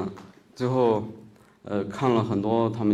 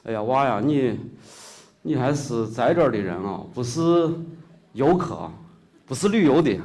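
hearty Shaanxi shopkeeper, louder and rising; local shopkeeper; 5 semitones higher, 7 dB louder than usual. Retold or imitated. Shopkeeper realizes the young man is local, loud Shaanxi dialect. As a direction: call out like a surprised old Xi'an shopkeeper.

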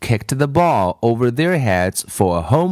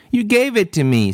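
put-on quick, high-pitched childlike protest; mildly acted playground indignation; indignant protest; 10 semitones higher, faster than usual. A narrator's reading. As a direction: protest fast, pitched well up. Acted quarrel over the ball.

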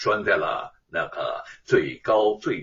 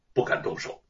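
usual speaking voice

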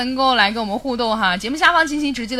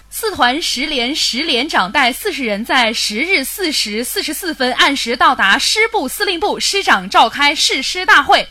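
slow and loud, word by word; tongue twister retake; 3 semitones higher, 5 dB louder, slower than usual. Shown as a demonstration. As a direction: recite the twister slowly and firmly. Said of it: "再来一遍".

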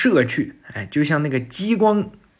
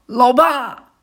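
a higher, louder call across the distance; shouting from afar; 8 semitones higher, 4 dB louder than usual. Acted. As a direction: yell out to dad from far away. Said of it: "博人在远处大喊道啊".

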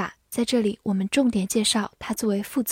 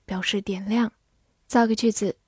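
ordinary delivery

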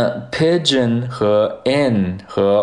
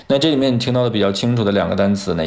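ordinary talk

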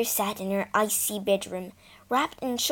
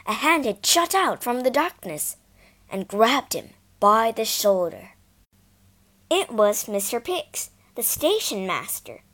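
ordinary delivery

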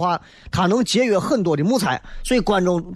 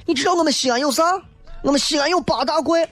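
high, mocking tourism pitch; mock promo voice; 9 semitones higher than usual. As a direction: parody a boastful tourism ad. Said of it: "不要随随便便上来就是".

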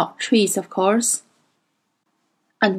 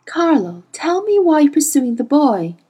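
ordinary delivery

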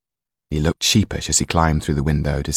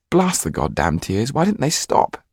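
angry; 6 semitones higher than usual. Acted. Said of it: "so angry at the tears".

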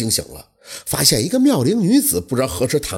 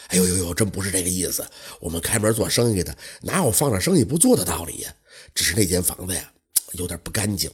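lower, softer innkeeper hedging; nervous innkeeper; 7 semitones lower, 4 dB quieter than usual. Acted. Innkeeper warning Huang Shou of the room, low and hushed. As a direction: innkeeper hints the room is haunted.

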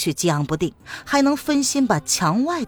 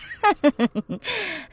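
high, ugly cackling laugh; harsh cackle; 11 semitones higher than usual. Acted. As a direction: cackle like a croaking owl. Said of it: "哈哈地笑了一阵". (刺耳的怪笑)

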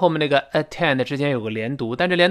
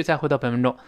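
ordinary talk

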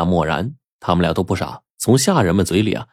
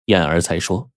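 ordinary delivery

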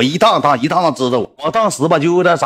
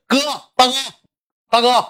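much higher imitated call; mock shouted greeting; 10 semitones higher than usual. Little brother obliged to greet boss, mock shout. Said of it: "过去大喊一声".

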